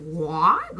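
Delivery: loud rising "What?" imitating mom; mom's "what". Mom hearing about the catfish from her kid, imitated loud "What?". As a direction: mimic mom's startled question, punched upward. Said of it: "that's literally how my mom says what".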